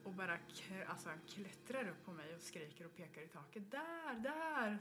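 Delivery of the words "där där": high, loud mock toddler yell; imitated child's scream. Boy climbing onto her, screaming at the ceiling, high imitated yell. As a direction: shout it like a terrified little boy pointing up. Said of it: "Så här".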